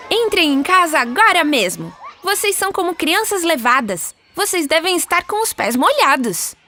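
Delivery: high-pitched, bossy little mother; motherly Wendy scolding. Acted. Wendy sends the wet-footed boys indoors, motherly high scolding. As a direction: order the children about in a high, lively voice.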